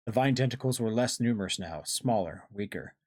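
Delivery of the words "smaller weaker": usual speaking voice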